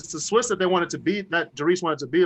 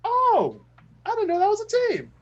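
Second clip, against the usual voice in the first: mock surprise. Quoting his own surprised reaction.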